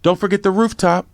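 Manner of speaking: a friendly retold call from below; retold yell up the stairs. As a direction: shout "Hey, man" up to the next floor. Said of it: "then he yelled up".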